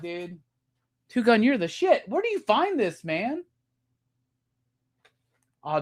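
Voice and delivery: normal talking voice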